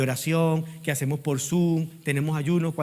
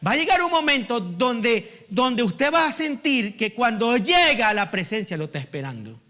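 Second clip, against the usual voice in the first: higher, insistent preaching voice; predicación enardecida; 6 semitones higher, 3 dB louder than usual. Real, not acted. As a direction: preach it with rising fervour, pitched well up. Preaches at raised pitch. (prédica encendida, con voz aguda e insistente)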